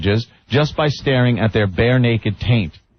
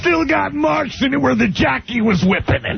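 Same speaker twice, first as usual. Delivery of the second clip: Don West rant; 10 semitones higher than usual. Retold or imitated. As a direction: yell it like a TV salesman. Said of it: "Don West yelling".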